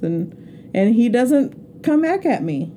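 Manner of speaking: ordinary delivery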